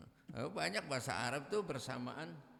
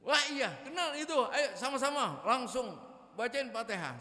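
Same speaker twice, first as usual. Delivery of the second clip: much higher, loud and eager; eager ustadz; 11 semitones higher, 6 dB louder than usual. Acted. Ustadz voicing a priest eager to pray for a friend. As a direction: jump in keen to pray for a friend.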